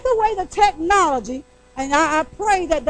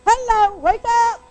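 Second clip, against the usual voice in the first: calling out; 5 semitones higher, slower than usual. Real, not acted. Preacher calling congregation awake.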